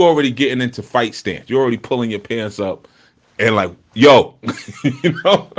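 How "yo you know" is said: mock tough "yo". Mimicking someone squaring up to fight.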